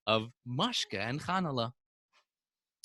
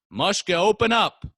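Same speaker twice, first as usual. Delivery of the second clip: raised, louder demand; loud, higher-pitched order; calling through door; 6 semitones higher, 6 dB louder than usual. Acted. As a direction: call out to someone behind a shut door. Landlord calls through the door.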